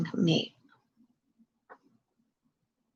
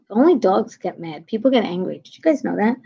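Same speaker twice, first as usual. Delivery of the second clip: usual speaking voice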